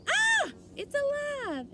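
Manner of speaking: high, loud, slow terrified scream; frightened scream. A narrator's reading. Man screams when the doll talks, terrified. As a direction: shriek as if the doll came alive. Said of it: "The man screamed".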